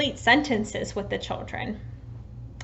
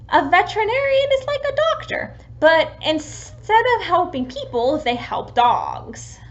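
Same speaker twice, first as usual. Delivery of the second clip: put-on teaching a child; 7 semitones higher, 5 dB louder than usual. Shown as a demonstration. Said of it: "Another example may be".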